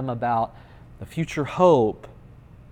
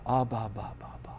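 hushed, fading syllables; near-whispered imitated babbling; soft baby babble; 7 dB quieter, faster than usual. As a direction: babble quietly like an infant calling for daddy. Babbled cry to the Father.

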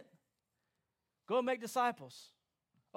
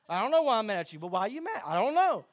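exasperated quarrel; 10 dB louder than usual. Acted. Acted friends' quarrel.

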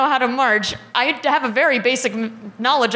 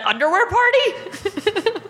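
laughing; 10 semitones higher, much slower than usual. Retold or imitated. Mocking the sketch pad note.

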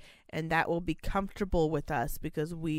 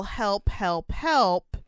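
imitating residents yelling for help, louder on the last word; mock cry for help, building in force; retold yell; 2 semitones higher, 4 dB louder, much slower than usual. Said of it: "sit there and yell".

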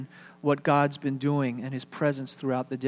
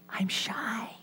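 soft, pitched-up bashful voice; shy child; 4 semitones higher, 7 dB quieter, slower than usual. Retold or imitated. Pastor imitates own kid being bashful, soft and high. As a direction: shrink back, hushed and small. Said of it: "My kid would say".

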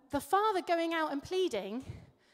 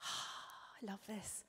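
whisper; 5 semitones lower, 16 dB quieter, much slower than usual. Real, not acted. Preacher delighting in the verse.

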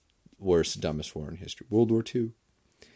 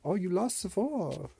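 mock German grandpa; 13 semitones higher than usual. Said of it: "they were like".

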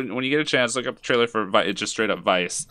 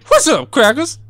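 much higher, louder botched president impression; badly faked president, pitched up and loud; George W. Bush; 14 semitones higher, 7 dB louder than usual. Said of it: "I'll do my, uh, George".

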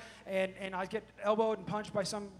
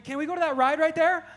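put-on higher, much louder excited child; eager kid asking; 9 semitones higher, 12 dB louder than usual. Son asking dad for a ride mid-search, eager and oblivious. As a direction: beg like a distracted kid.

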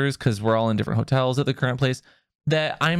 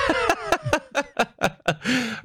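high burst of laughter; 12 semitones higher than usual. Real, not acted. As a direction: crack up high. Reacting to pun.

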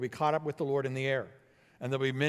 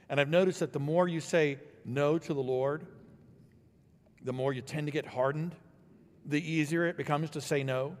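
ordinary talk

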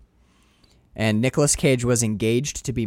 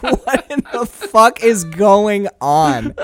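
incredulous; 6 semitones higher, 5 dB louder, much slower than usual. Retold or imitated. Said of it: "You're like".

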